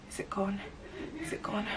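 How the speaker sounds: anxious unvoiced whisper. Waking up and checking her eye, tense whisper. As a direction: breathe the question out, barely there.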